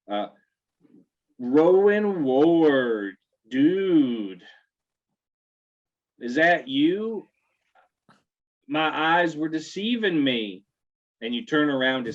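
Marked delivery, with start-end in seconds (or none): 1.4-10.6 bro calling out. Acted. GM voices a bro calling Rowan.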